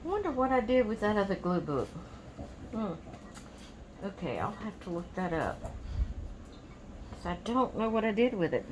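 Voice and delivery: muttering to herself. Real, not acted. Muttering to herself about lost book.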